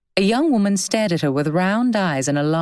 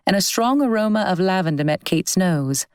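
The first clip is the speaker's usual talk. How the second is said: plain reading voice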